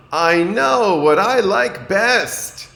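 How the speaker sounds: high, loud and slow; a child who has decided at last; big pleased little-boy voice; triumphant young Franklin. A narrator's reading.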